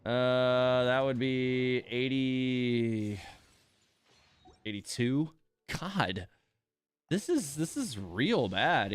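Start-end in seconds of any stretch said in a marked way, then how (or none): none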